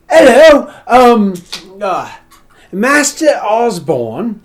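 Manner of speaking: put-on high, loud, slow affected servant accent; put-on posh accent, over-polite servant; fancy put-on butler accent. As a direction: play a butler faking a posh accent badly. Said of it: "his accent was worse than mine".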